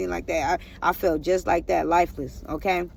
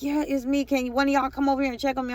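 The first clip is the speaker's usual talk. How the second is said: slightly hammed silent begging, higher pitch; retold inner plea; 5 semitones higher, faster than usual. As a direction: lift the pitch, hoping to be noticed. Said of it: "inside me, I'm like".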